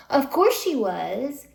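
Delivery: loud breathy sarcastic "course"; an exasperated "of course she was". Real, not acted. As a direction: scoff at it, drawn out. Sarcastic retort.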